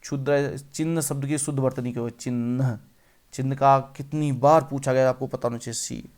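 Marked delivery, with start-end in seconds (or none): none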